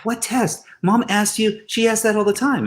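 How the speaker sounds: high-pitched, puzzled protest; imitated youngster's disbelief; incredulous teen. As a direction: act out a baffled son, pitched well up. Teenage son's puzzled reaction, retold.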